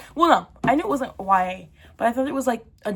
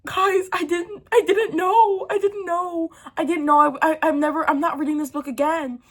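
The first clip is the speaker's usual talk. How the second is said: panicked protest; 7 semitones higher, 3 dB louder than usual. Retold or imitated. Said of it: "I'm like".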